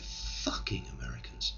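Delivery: low, muttered under the breath; quiet muttered curse. Retold or imitated. Man mutters to French girl about passing Americans, quietly. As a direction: mutter it so only she hears.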